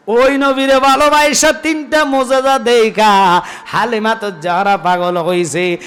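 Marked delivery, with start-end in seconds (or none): 0.1-4.1 high, loud melodic proclamation; impassioned preaching. Real, not acted. Preacher telling how Halima saw three miracles, impassioned preaching. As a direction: drive the line out to the crowd.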